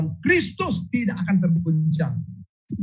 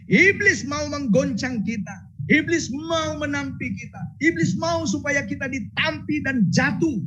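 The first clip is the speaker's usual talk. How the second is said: fervent preaching; 10 semitones higher than usual. Acted. Preaching rising.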